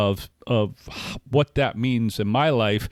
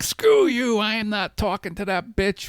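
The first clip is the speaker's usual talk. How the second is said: hammed-up indignant refusal, pitched high; mock angry outburst; 10 semitones higher than usual. Retelling his retort to his sponsor, hammed-up furious.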